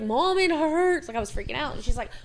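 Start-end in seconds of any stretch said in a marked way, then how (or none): 0.0-1.1 high, slow fake whimper to mom; hammed-up tearful plea; faked crying. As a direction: put on a hurt little cry to get mom home. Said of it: "talked myself into, like, crying".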